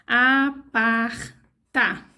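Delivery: "Aparta" stretched out very slowly; very slow sounding out. Shown as a demonstration. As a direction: drag "Aparta" out as you write it. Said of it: "building the word syllable by syllable".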